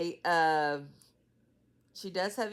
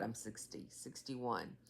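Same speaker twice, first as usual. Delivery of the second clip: much softer, dropped low; quiet aside; 5 semitones lower, 10 dB quieter than usual. Real, not acted. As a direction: mutter the correction to yourself. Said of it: "Oh my God".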